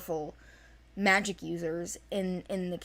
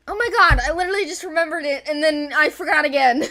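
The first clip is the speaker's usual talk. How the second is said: exasperated outburst; 8 semitones higher, 11 dB louder, faster than usual. Real, not acted. Laughing frustration at forgetting.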